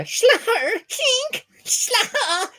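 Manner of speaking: very high nonsense cartoon exclamations; high-pitched cartoon ad-lib; Rolf. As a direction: squeal out goofy cartoon catchphrase noises. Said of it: "little Rolf isms".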